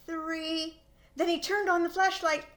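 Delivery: ordinary delivery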